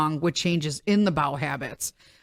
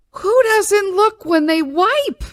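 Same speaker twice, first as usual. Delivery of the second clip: high, slow disbelieving question; incredulous; 11 semitones higher, 5 dB louder, slower than usual. Retold or imitated. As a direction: ask it in total disbelief. Incredulous inner thought, high pitch.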